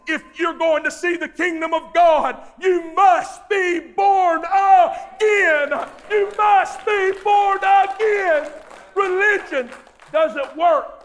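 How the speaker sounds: slow, loud, high preaching shout; roaring revival preacher; full-force preaching. Acted. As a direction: thunder it from the pulpit.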